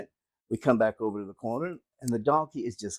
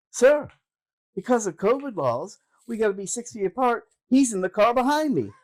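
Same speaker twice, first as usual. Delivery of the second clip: higher, louder excuse to the officer; mock earnest excuse; 9 semitones higher, 3 dB louder than usual. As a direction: sell the excuse brightly.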